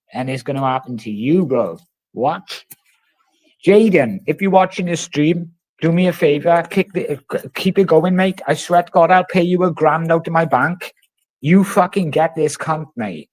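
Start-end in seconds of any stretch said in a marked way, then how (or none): none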